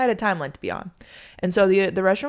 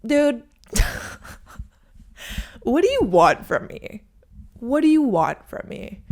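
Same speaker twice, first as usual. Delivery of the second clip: louder, higher, slow incredulous plea; exasperated; 6 semitones higher, 5 dB louder than usual. Real, not acted. Venting to viewers about the server, loud and exasperated. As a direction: throw up your hands at him.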